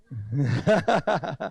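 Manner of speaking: rapid ha-ha laugh; teasing laugh. Real, not acted. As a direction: burst into a run of chuckles after teasing. Laughs after teasing someone.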